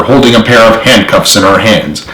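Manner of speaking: plain reading voice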